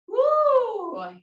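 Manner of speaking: loud, high shout of exertion; effort whoop. Real, not acted. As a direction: shout it out through the burn. Whoop during static hold.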